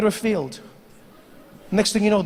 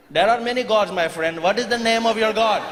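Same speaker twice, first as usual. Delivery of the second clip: put-on Indian accent; 3 semitones higher than usual. Indian farmer questioning.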